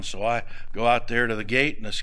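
normal talking voice

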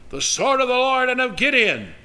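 loud high shouted war cry; battle cry. Retold or imitated. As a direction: shout it like an army charging. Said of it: "screaming and yelling".

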